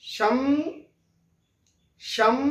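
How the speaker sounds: शम and सम closing on a humming म with the lips shut; सम said with the mouth closing on a nasal म; anusvara demo. Shown as a demonstration. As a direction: hum the final म of सम through the nose. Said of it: "मुंह बंद हो जा रहा है और ये नाक से उम".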